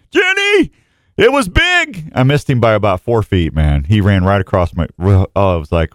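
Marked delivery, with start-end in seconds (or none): 0.1-0.7 high, drawn-out call of the name. Acted. Host excitedly calls Jenny about a near-miss buck, calling out high. 1.2-2.1 high-pitched, excited boast; excited exclamation. Real, not acted. Host tells Jenny about the buck he nearly hit, excited exclamation.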